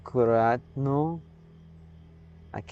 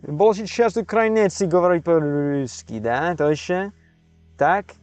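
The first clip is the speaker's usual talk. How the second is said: high, loud mock Russian accent; 5 semitones higher, 4 dB louder than usual. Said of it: "I'm just going with the stereotypical Russian accent".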